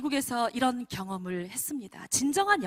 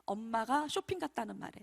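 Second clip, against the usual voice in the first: ordinary talk, nothing marked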